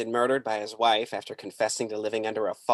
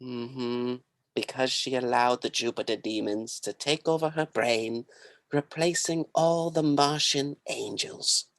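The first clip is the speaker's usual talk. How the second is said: cult guru voice. Acted. Guru character.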